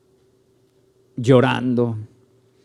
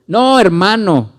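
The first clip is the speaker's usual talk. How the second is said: mock protest; 7 semitones higher, 6 dB louder, slower than usual. Mock reply to a believer.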